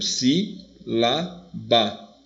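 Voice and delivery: SI, LÁ, BA as separate beats with pauses; teacher spacing out si, lá, ba; split into syllables. Shown as a demonstration. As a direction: break sílaba apart: si... lá... ba. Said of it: "Quando você separa, por exemplo".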